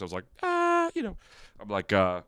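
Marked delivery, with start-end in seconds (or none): 0.4-1.2 a single high falsetto "Ah". Shown as a demonstration. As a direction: jump way up into head voice for "Ah". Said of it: "like, in my falsetto voice".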